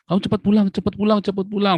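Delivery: high, loud, urgent hurry-home call; a relative shouting down the phone. Retold or imitated. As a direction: press him to come home now. Relative phones urging him home.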